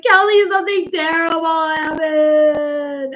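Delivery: slow, loud, dramatic reveal; "Kelly, something terrible happened" said slowly and loudly; urgent announcement. Retold or imitated. Telling her friend about the stalker, slow loud and dramatic. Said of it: "I was like".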